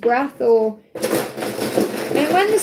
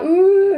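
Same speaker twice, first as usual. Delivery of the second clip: surprised ooh; 7 semitones higher, 6 dB louder than usual. Retold or imitated. Reacting to dad's advice.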